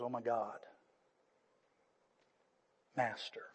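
low, very soft awe, acted; hushed recognition. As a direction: murmur it as Mary suddenly recognizing him, long pause between. Mary recognizes the risen Jesus.

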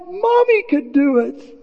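put-on high, drawn-out child's cry; imitated pleading boy. Boy urging his dad to climb up, high pleading call. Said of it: "remember the boy, he goes".

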